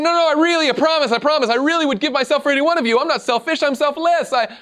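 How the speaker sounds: high, loud, rushed protest; frantic pleading. Acted. God frantically insists to Satan he's selfless, high and rushed. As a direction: scramble to prove you're not selfish.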